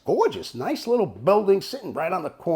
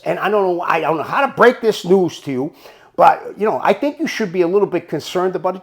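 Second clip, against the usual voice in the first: ordinary talk, nothing marked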